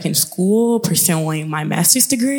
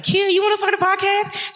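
much higher, eager pitch to her friend; excited question. Retold or imitated. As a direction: pop the idea with excitement. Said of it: "And I was like".

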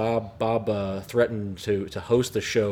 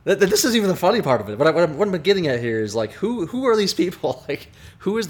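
excited; 7 semitones higher, 4 dB louder than usual. Real, not acted. Laughing, exasperated about the commentator.

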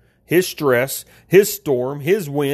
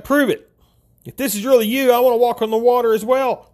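higher-pitched doubter's demand; mock challenge; 8 semitones higher, faster than usual. Peter demands that Jesus prove it, retold as a high challenge. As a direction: lightly act out a high, insistent challenge. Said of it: "So Peter asked Jesus to prove it".

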